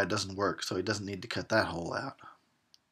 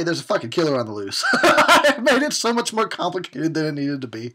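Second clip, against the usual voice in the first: loud, fast laughing blurt; real laughing outburst; laughing shout; 4 semitones higher, 8 dB louder than usual. Real, not acted. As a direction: burst out laughing at yourself.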